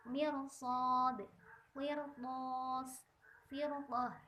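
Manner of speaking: mirsad with the r thick and heavy; thick r again. Shown as a demonstration. Teacher repeating the correct thick r. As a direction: firqah with a full, dark r. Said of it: "harus dibaca tebal".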